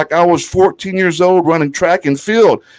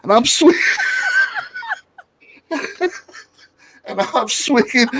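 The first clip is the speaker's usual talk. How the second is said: laughing; 14 semitones higher, 3 dB quieter than usual. Real, not acted.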